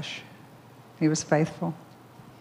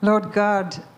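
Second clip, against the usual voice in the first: praying aloud to God, a touch raised and fervent; prayer; 3 semitones higher than usual. Acted. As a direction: pray out with fervour. Opens a prayer.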